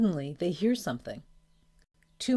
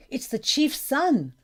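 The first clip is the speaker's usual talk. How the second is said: high, loud urgent exclamation; alarmed; 8 semitones higher, 6 dB louder than usual. A narrator's reading. Girl spotting the chief's son in the river, alarmed. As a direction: call it out in alarm. Girl sees boy drowning.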